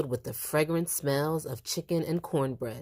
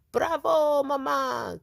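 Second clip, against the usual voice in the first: cheering; 11 semitones higher, slower than usual. A narrator's reading. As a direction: cheer the toast with delight. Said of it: "cried Tia Rosa".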